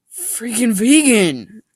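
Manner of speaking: slow, loud, high scornful jeer; mocking. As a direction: jeer at the vegan. Mocking a vegan friend.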